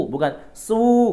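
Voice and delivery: "su" light and thin, the wrong way. Shown as a demonstration. Teacher shows the wrong thin "su". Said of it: "Kalau su tipis".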